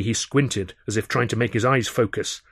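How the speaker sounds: usual speaking voice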